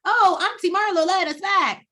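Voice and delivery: high, loud mock teenager; mock naive teens. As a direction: ham up the happy kids. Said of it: "they just gonna be hunky dory".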